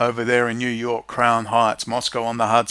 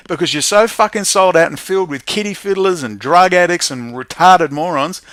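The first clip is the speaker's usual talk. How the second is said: ordinary delivery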